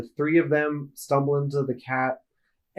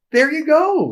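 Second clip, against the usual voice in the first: much higher, bright triumphant call; excited cheer; 11 semitones higher, 4 dB louder than usual. Real, not acted. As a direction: cheer it out with a grin. Cheering on co-host.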